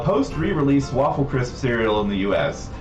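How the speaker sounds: normal talking voice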